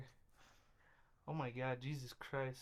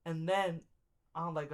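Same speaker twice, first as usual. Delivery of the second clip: ordinary talk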